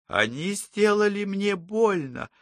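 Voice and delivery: put-on high, slow little-girl voice; childlike babble. A narrator's reading. Patient tells her psychologist she was hurt, in a little child's voice. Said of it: "пролепетала она детским голоском".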